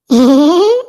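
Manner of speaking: a short rising laugh; chuckle. Acted. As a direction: laugh at his own joke. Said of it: "दांत क्यों फाड़ रहे हो?".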